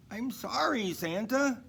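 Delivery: high, pleading voice of a tired horse. A narrator's reading. The horse apologizing to Santa, high and sorry. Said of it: "said the horse".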